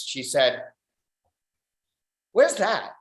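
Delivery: normal talking voice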